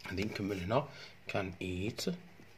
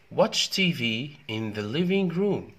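slow, loud, carefully spaced reading; teacher's slow clear model; slow model sentence; 5 semitones higher, 5 dB louder, much slower than usual. Shown as a demonstration. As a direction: read it out slowly for learners.

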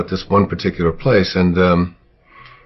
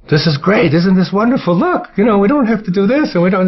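put-on bright, much higher child voice, thrilled; mock delighted child; 13 semitones higher than usual. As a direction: squeal it like a happy toddler. Mother quotes toddler's cheerful words.

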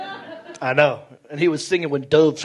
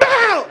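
put-on sharp high yelp of a startled kid; sudden pained cry, high. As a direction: jump and yelp as if scared. Dad scares him, child yelps.

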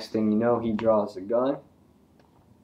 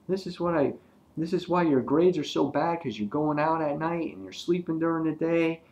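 imitating angry dad; 8 semitones higher than usual. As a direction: rant like a disbelieving dad. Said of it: "his dad's like".

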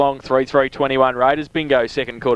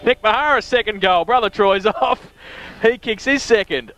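higher-pitched, rising play-by-play; excited goal call; 6 semitones higher than usual. Real, not acted.

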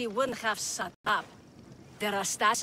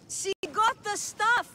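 higher, slow, spelled out for a deaf ear; slow and raised; 9 semitones higher, slower than usual. Acted. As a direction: talk up slowly to a hard-of-hearing boss. Speaks up to deaf boss.

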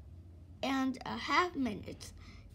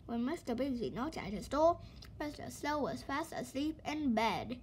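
ordinary delivery

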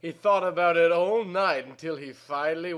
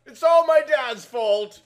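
loud, higher anguished cry; 7 semitones higher, 10 dB louder than usual. A narrator's reading. Girl caught by her lover, crying out loud. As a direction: blurt the blame out loud. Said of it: "she cried".

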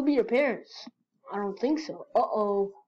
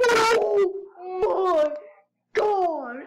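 higher, louder horrified cry; terrified cry; 7 semitones higher, 6 dB louder, much slower than usual. Acted. Friend realising something else licked her hand, crying out in terror. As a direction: scream it out in terror. Reacting to the note's twist.

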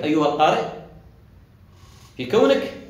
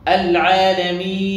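العالمين chanted louder, final ee held level; four-count madd; 3 semitones higher, 5 dB louder, slower than usual. Shown as a demonstration. Teacher shows finger-counted timing, العالمين with medium stretch. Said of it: "أربع حركات".

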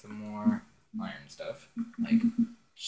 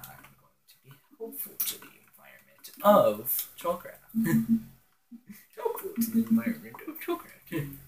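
wild unhinged ranting; 10 semitones higher than usual. Pretend. Villain joke about Trollcraft.

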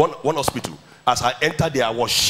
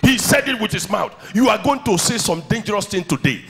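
fiery preacher rising in pitch; preaching at full force; 6 semitones higher than usual. Acted. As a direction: build it like a revival sermon. Preaching about David and Goliath.

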